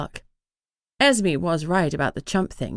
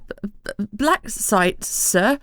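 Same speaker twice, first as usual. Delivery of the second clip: nervous clerk stuttering on each b; stammering; 3 semitones higher, slower than usual. A narrator's reading. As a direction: trip over the words, frightened. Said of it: "The clerk paled and stammered".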